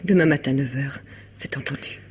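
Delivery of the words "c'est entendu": hushed. Acted. Quiet agreement before visitor arrives.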